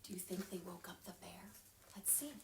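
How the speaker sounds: fast hushed whisper; secretive whisper. Shown as a demonstration. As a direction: whisper so the bear sleeps. Whispered question to children.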